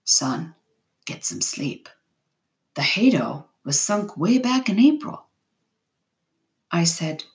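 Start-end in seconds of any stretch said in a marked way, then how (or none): 0.0-5.3 quiet, breathy disbelief kept gentle; said quietly. A narrator's reading. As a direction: speak softly to send a boy to rest. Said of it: "he said quietly".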